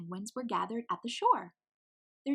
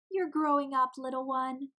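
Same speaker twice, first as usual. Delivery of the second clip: fond mama penguin; 6 semitones higher, 5 dB louder than usual. Acted. Mama voiced with a smile.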